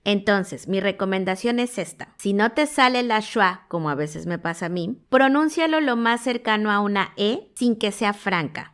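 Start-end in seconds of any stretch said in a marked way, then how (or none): none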